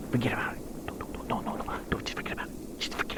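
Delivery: urgent frantic whisper; hushed whisper. Acted. Man hushing his companion behind the door, urgent whisper. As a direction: hiss it so nobody outside hears.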